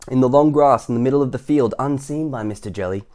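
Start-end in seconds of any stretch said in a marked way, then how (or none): none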